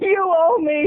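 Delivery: high, loud accusing cry; mock outburst. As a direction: wail the demand in hammed-up outrage. Mock outburst at Katie Holmes.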